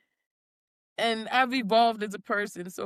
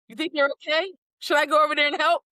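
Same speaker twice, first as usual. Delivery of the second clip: eager helper voice; 6 semitones higher, 4 dB louder, faster than usual. Retold or imitated. Voicing friend, eager.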